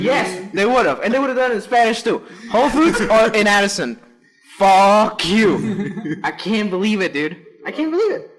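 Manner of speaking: high, loud excited outrage; worked-up rant. Real, not acted. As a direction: still riled up, laughing at the disaster. Ranting at Whole Foods while laughing.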